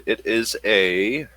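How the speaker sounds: usual speaking voice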